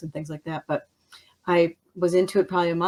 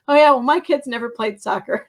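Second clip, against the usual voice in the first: mock comeback; 6 semitones higher, 6 dB louder than usual.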